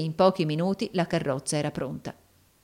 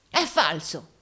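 exclaimed denial; slower than usual. A narrator's reading. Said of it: "esclamò Rodolfo ritraendosi".

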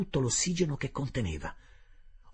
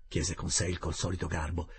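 plain reading voice

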